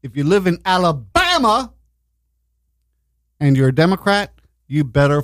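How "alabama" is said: drawn-out, high-pitched movie-quote Alabama; Forrest Gump. Retold or imitated. Host announcing the state, Forrest Gump-style drawl. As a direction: stretch it out and pitch it up. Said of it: "why I have to say it like that".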